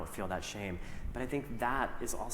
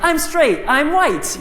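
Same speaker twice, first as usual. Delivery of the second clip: 1 mock confident teen; 15 dB louder, slower than usual. Mocking his middle school self.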